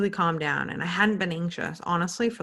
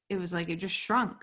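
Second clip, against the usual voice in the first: ordinary talk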